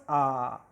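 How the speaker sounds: a low, soft, drawn-out "Ah"; isolated vowel sound. Shown as a demonstration. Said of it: "what sound comes first".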